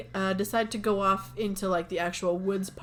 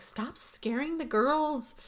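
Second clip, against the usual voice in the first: mock scolding; 6 semitones higher, slower than usual. [name] scolds Rich.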